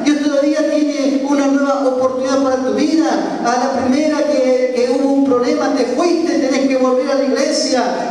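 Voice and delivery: put-on high, urgent pleading to a lost young man. Preacher urging the young robber to return to church, high urgent plea.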